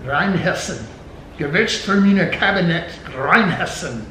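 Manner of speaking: loud, hammed-up German pronunciation; German accent. Shown as a demonstration. Reading the German wine label aloud, German accent. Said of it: "Better than my English accent".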